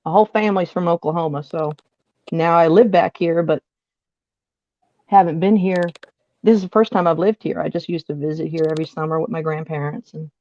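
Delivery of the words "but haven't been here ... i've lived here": ordinary talk, nothing marked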